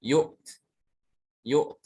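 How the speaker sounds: yuk cut short with a released t; stopped without ya. Shown as a demonstration. Teacher showing the pause form, cut short. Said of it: "ketika wakaf dibacanya".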